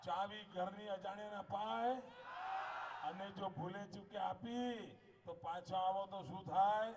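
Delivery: loud, high rally speech to the crowd; rally oratory. Real, not acted. Politician rousing a rally crowd, loud and high. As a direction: preach it at full force to the crowd.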